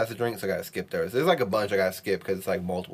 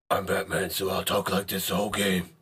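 Batman voice. Acted. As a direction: growl it like a caped vigilante. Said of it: "I gotta talk like Batman".

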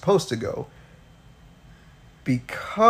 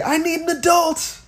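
very high hammed-up plea; mock cry for help. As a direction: cry out like a child wanting a grown-up. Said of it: "the same way anybody would say".

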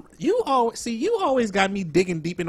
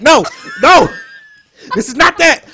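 emphatic correction; 8 semitones higher, 11 dB louder than usual. Real, not acted. Host corrects wrong show name.

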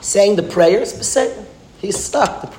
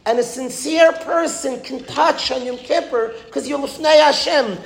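preaching at full force; 9 semitones higher than usual. Acted. Rabbi urging his listeners that Yom Kippur is real, fervent preaching. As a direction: drive each truth home like a rousing rabbi.

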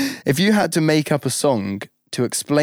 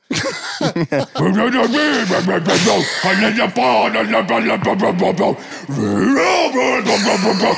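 high strained scream of nonsense; metal scream babble; 6 semitones higher than usual. Pretend. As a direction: howl like a metal singer.

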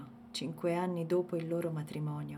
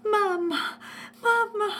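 put-on very high, loud child's shriek; 6 dB louder, slower than usual. A narrator's reading. Little Youssef shrieking for his wounded mother. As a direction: scream for mom in terror. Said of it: "cominciò a strillare".

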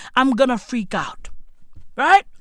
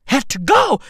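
normal talking voice

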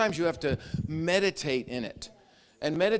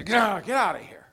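louder, commanding get out; firm rebuke; 5 semitones higher, 5 dB louder than usual. Pretend. Preacher rebuking demons, firm and loud. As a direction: drive the demons off firmly. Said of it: "You just gotta say".

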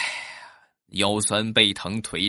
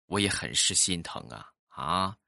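ordinary delivery

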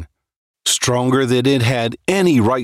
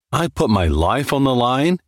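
plain reading voice

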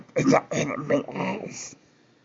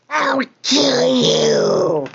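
drawn-out, louder, tearful threat; slow threat; 6 semitones higher, 4 dB louder, slower than usual. Acted. Creature threatening the stranger who mocks it, slow and tearful. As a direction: threaten him slowly, near tears.

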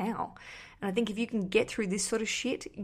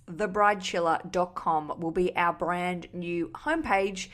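slow, deliberate plug. Shown as a demonstration. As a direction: spell the name out slowly so it sticks. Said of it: "get it locked in to your brains".

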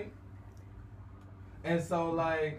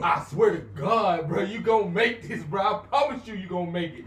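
passionate hype; 2 semitones higher, 6 dB louder than usual. Retold or imitated. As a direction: pump him up like a brother. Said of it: "extra passionate".

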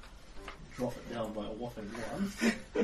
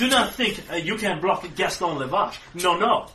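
indignant pompous Frenchman; 5 semitones higher, 7 dB louder than usual. Acted. Lavache insists, indignant.

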